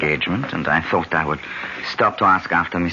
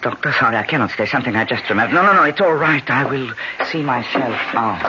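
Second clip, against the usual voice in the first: hurried, higher, agitated leave-taking; 7 semitones higher than usual. Acted. The Count rushes off from Minna's bleeding hand, agitated. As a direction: get out fast, rattled. Count flees after seeing blood.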